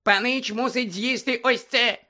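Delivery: high, slow squeaky elf voice; Dobby the house-elf squeaking at Harry. Acted. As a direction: squeak it like an anxious little elf. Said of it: "пропищав ельф".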